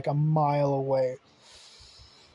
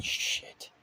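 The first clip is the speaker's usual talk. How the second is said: breathy whispered shit; whispered curse; 6 dB quieter than usual. Acted. Mark whispering on seeing the bodies. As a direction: let it slip out in a whisper. Sees dead bodies and dad.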